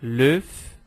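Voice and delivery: "L'œuf" with the f sounded; F final prononcé. Shown as a demonstration. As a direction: end "L'œuf" on a clear f. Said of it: "pronúncia do singular dessas".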